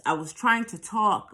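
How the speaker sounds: ordinary delivery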